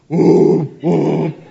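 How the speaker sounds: big blaring horn imitation, hooted loudly; loud truck horn. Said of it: "with a loud Ford G M horn".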